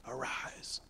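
soft, low whisper; whispered command. Acted. Jesus to the dead girl at her bedside, whispered command. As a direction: breathe the word out gently, barely voiced.

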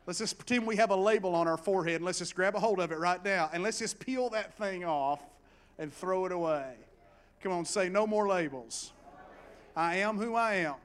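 loud, rallying preacher call; preaching at full force. Real, not acted. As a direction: lead the congregation in a bold declaration. Rallies congregation to repeat declaration.

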